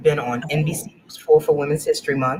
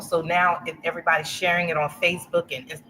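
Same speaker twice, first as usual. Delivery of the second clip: ordinary delivery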